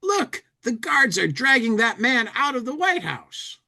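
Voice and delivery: high, animated reading of a cartoon character; comic bubble voice. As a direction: pitch it way up like a cartoon tourist.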